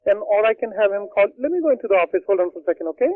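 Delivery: ordinary talk, nothing marked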